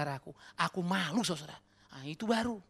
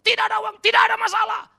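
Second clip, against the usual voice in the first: high, loud mocking boast; mocking shout; 15 dB louder than usual. Preacher mocking a false faith to the congregation, loud and high.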